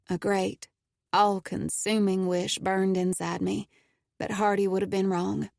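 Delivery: ordinary delivery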